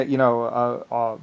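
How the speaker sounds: ordinary talk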